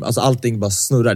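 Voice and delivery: ordinary talk, nothing marked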